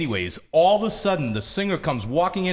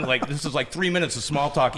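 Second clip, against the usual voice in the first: usual speaking voice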